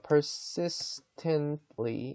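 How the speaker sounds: persistently drawn out very slowly, soft; slow careful pronunciation. Shown as a demonstration. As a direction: read persistently slowly with the t unreleased. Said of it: "지금 제가 읽는 거".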